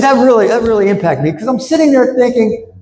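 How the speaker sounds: a hint of David's earnest voice; friend's retold words. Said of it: "He said".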